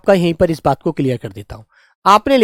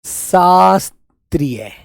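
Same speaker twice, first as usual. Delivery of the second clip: शास्त्रीय stretched very slowly; slow dictation; much slower than usual. Shown as a demonstration. Teacher repeating the dictation word, very slow. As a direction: draw out शास्त्रीय syllable by syllable. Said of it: "शास्त्रीय लिख कर दिखा दो".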